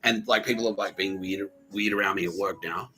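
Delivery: ordinary talk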